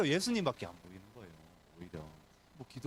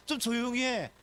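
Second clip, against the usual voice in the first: scolding crowd; 6 semitones higher than usual. Acted. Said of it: "꾸짖기 시작하는".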